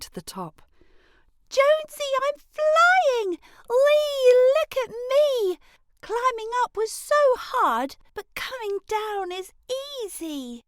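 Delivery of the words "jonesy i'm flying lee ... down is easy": much higher, louder, whooping with delight; joyful shouting. Acted. Girl flying with the butterflies shouts with joy. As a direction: shout it for the whole world to hear.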